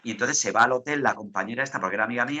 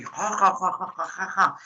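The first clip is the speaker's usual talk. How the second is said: higher mock "ja, ja" laughing fit; imitated laughter; 6 semitones higher than usual. Radio host re-enacting his laughing fit, mock laughter. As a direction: act out a contagious laugh. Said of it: "se empieza a reír sin domí".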